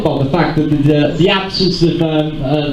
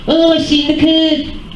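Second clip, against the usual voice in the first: higher, slow mocking voice of the guy; mocking impression; 10 semitones higher than usual. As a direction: send up his dopey line, slowly. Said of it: "does when he comes down the gangway is say".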